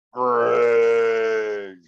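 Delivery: mock the creature calling his name out loud from behind the tent; mimicked loud call. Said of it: "immediately it said it again".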